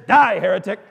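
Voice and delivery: sudden very loud shout; mock-righteous roar; furious condemnation. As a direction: explode on the punchline. Punchline, rescuer turns on jumper.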